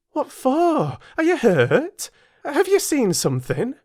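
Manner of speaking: high, urgent maid's questions; Bessie demanding. Acted. Bessie questions the frightened child, high and urgent. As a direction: press the child for an answer. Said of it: "again demanded Bessie".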